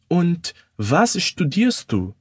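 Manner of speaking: slow, careful question; slow demo question; slow model line. Shown as a demonstration. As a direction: ask it slowly for learners. Teacher models German line slowly.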